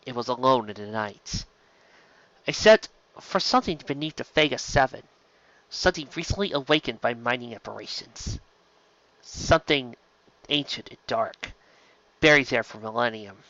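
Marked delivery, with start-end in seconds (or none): none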